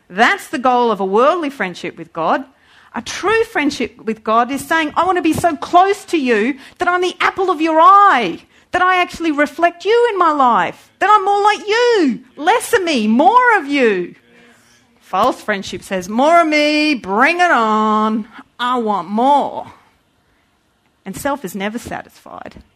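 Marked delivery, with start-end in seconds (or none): 5.0-14.2 heartfelt plea. Acted. Preacher voicing prayer to God. 16.1-19.7 greedy demand. Acted. Voicing greedy false friendship.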